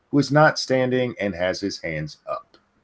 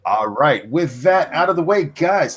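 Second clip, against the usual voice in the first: normal talking voice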